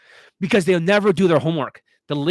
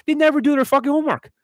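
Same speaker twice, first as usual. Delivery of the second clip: much higher, louder, faster exasperation; exasperated outburst; 12 semitones higher, 5 dB louder, faster than usual. Real, not acted. Coach exploding about students skipping homework, higher and louder. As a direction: burst out in frustration.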